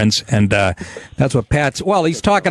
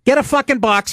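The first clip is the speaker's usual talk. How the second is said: higher mock mobster sneer; Christopher; 9 semitones higher than usual. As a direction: needle the kid like a wiseguy. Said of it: "the kid goes".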